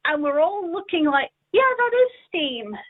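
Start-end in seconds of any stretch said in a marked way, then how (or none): 1.5-2.8 higher, surprised realization of steam; the penny drops, voice jumps up. Retold or imitated. Child realizing the ghosts are steam, surprised.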